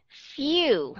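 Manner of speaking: FEW said long with a y-glide before the oo; contrasting few. Shown as a demonstration. Teacher showing students the glide in few, drawn-out demonstration. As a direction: stretch "few" as f-yoo, clearly glided. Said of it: "Few và full nó khác nhau".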